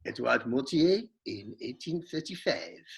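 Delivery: put-on French accent on name and year. Player echoing the quizmaster's marshal, playful French accent. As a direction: repeat it in a mock French accent. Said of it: "we want it in [name]'s French".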